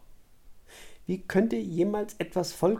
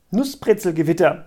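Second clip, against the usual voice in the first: raised voice, tossing out a word; called out; 7 dB louder than usual. A narrator's reading. Lysandra calls out her word suggestion to her friends, loudly. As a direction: call it out a bit louder. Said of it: "rief Lysandra".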